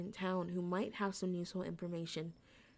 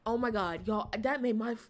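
shaken; 2 semitones higher, 7 dB louder than usual. Real, not acted. Reacting to jump scare.

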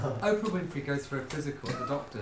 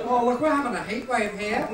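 put-on loud, higher London accent; 8 semitones higher, 4 dB louder than usual. Joking about the July heat, London accent. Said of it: "a London accent".

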